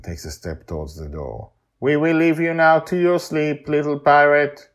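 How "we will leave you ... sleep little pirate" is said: even, formal machine voice; robotic helper voice. Acted. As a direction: speak like a polite robot.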